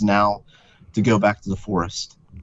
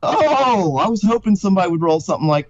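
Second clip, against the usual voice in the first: excited; 8 semitones higher than usual. Real, not acted. Delighted at the roll.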